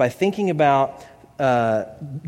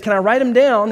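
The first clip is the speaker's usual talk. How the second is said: higher, louder eager question; excited client; 6 semitones higher, 5 dB louder than usual. Acted. Client asks therapist about writing down the words, excited. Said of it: "she's so excited".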